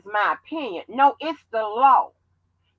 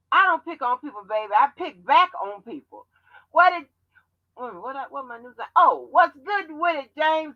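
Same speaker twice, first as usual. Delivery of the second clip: ordinary talk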